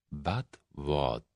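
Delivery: very soft, slow menace; dangerous. A narrator's reading. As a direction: leader sensing trouble, menacing. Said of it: "a dangerous quality".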